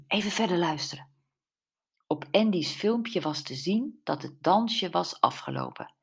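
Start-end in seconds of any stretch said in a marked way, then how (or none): none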